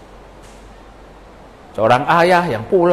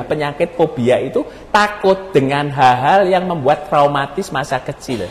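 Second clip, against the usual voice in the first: usual speaking voice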